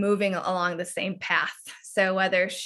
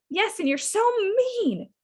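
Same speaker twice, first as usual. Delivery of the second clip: put-on much higher, whiny little girl; whiny child voice; 13 semitones higher than usual. Shown as a demonstration. Teacher voices the child's reply to mom, whiny and much higher. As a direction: pitch up into a pouting child. Child role, high and odd.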